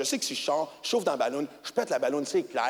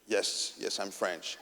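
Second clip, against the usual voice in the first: meek whisper; 12 semitones lower, 10 dB quieter, much slower than usual. Retold or imitated. Himself answering the cop meekly.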